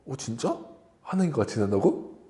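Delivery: whisper-soft wonder, intrigued; curious surprise. Retold or imitated. Eve hearing the serpent, softly intrigued. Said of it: "이렇게 생각을 했던 거예요".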